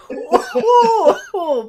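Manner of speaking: high, loud laughing oh. Real, not acted. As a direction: laugh through the words. Laughing at a joke on air.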